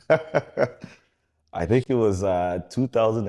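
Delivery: slow, laughing start. Real, not acted. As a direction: laugh through the words as you begin. Laughing while recalling emotional memory.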